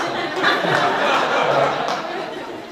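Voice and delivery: high airy laugh at his own mistake; real laugh. Real, not acted. Laughing with the audience about the snake that was no snake. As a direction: let the laugh break out loud.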